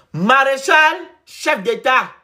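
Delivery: loud, high-pitched proclamation; ringing mock tribute to a ruler; mock acclamation. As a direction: proclaim the title like a herald.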